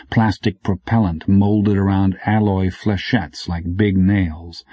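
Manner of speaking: plain reading voice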